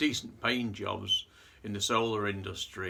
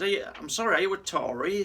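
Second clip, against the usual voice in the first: higher, louder indignant demand; indignant question; 10 semitones higher, 5 dB louder, faster than usual. Retold or imitated. Union man demands if his attacker is a Tory, higher indignant. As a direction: accuse him, bristling with outrage. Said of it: "He says".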